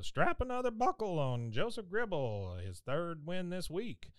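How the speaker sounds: a hint of a booming arena announcer; rodeo announcer. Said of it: "and the announcer says".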